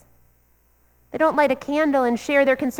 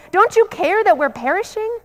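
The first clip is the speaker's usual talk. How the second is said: high, urgent cry of alarm; frightened disciples crying out to a sleeping Jesus; panicked plea; 7 semitones higher than usual. Retold or imitated. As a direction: plead in a panic from the sinking boat. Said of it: "They are panicked".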